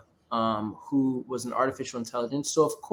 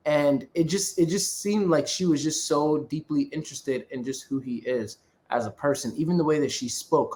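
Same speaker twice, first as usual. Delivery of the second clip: normal talking voice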